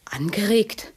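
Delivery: whispered disbelief; shocked whisper. Acted. Woman echoes his accusation, whispered in shock. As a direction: echo the word in a horrified whisper.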